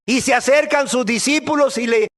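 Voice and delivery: usual speaking voice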